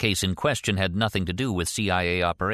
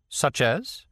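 higher, rising challenge; demanding question; 7 semitones higher than usual. A narrator's reading. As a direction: demand an answer with a lifted question. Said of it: "she demanded".

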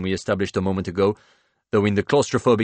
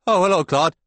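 raised, awkward hello; startled forced greeting; 10 semitones higher, 7 dB louder than usual. A narrator's reading. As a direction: greet him caught off guard. Caught at door, forced greeting.

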